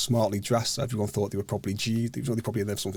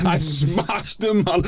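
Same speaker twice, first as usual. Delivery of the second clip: laughing boast; 7 semitones higher, 3 dB louder, much slower than usual. Real, not acted.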